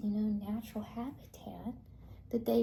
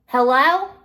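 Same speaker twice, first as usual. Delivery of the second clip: loud, higher hello with a rising end; shouted hail; 4 semitones higher, 17 dB louder than usual. Acted. Sheep protector hails intruders, shouted questioning call. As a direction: call it out across a distance.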